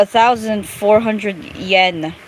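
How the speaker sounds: ordinary talk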